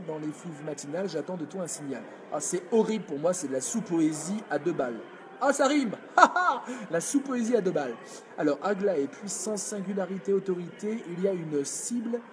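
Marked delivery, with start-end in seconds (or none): 5.4-6.6 laughing. Real, not acted. Mocking student's poem.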